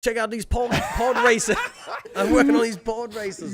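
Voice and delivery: high, laughing hammy Aussie; silly Australian bragging voice; mock Australian accent. Riffing Aussie Jake to his friend, mock Australian. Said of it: "Was he Australian now?".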